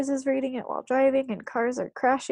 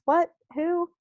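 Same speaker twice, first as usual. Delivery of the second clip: high, startled questions; voice gone funny; 6 semitones higher than usual. A narrator's reading. Father hears who is phoning, voice jumps up high in shock. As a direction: squeak it out in sudden shock. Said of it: "his voice goes all funny".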